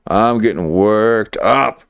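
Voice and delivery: slow, drawn-out WORKED UP, rising at the end. Real, not acted. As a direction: stretch "worked up" and lift the pitch on UP. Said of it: "I'm getting worked up right now".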